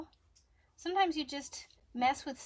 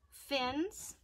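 usual speaking voice

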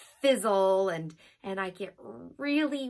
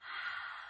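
slow unvoiced exhale after holding; long breath out; 13 dB quieter than usual. Shown as a demonstration. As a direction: let the held breath out softly. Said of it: "It goes like this".

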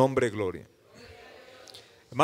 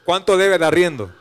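louder, higher imitation of a blunt question; insistent demand; 4 semitones higher, 9 dB louder than usual. Employer quizzing the embarrassed pastor about back rent, insistent demand. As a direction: press the question firmly, acting the other man. Hombre interroga al pastor avergonzado.

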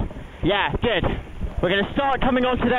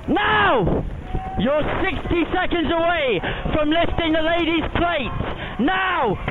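shouting; 5 semitones higher, slower than usual. Real, not acted. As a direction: drive them home at full voice. [name] calls the final sprint.

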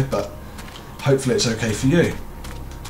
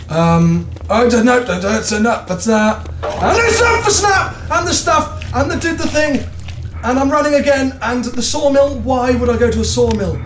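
high, rushed panicked babbling; flustered babble; 9 semitones higher than usual. Real, not acted. Confused player babbling high and flustered about the sawmill. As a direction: gabble in a fluster.

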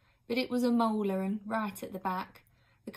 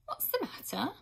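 put-on a girl's curious question, just a bit higher; a hint of a young girl asking; lightly voiced girl; 9 semitones higher, 3 dB quieter than usual. A narrator's reading. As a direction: ask it simply, like a curious girl. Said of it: "asked Odge".

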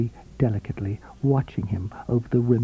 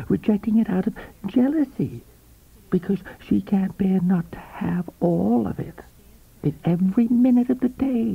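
higher, knowing, silvery woman's voice; 7 semitones higher than usual. A narrator's reading. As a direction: coax him like a confidante. Coral's voice in the story.

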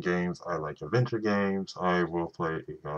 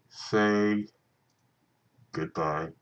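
ordinary talk, nothing marked

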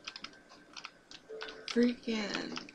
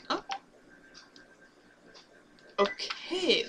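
ordinary talk, nothing marked

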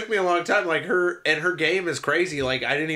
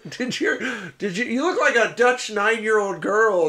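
higher voice, laughing through the jab; laughing mock insult; 6 semitones higher than usual. Retelling the show's roast of a hockey guy, laughing. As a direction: jeer and crack up at your own insult. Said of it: "he goes".

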